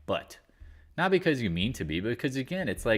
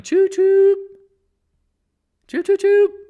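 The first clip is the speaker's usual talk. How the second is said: very high, loud choo choo like a steam train; train whistle; 13 dB louder, much slower than usual. Pretend. Streamer warning traders of the coming crash with a loud train whistle.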